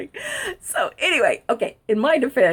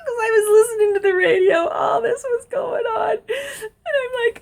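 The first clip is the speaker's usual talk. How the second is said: laugh-crying; 8 semitones higher than usual. Real, not acted. Breaking up while telling it.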